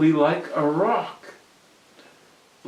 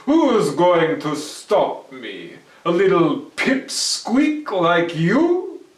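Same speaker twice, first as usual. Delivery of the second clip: louder, slow mocking challenge; Herschel, scornful; 4 semitones higher, 9 dB louder, slower than usual. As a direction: sneer down at a pipsqueak.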